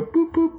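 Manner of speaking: boop the nose playfully. Acted. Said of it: "I go".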